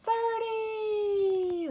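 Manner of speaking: high triumphant shout; excited shout. Pretend. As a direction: announce it like a big win. Reaching thirty triumphantly.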